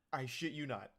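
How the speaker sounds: usual speaking voice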